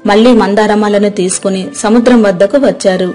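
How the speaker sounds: plain reading voice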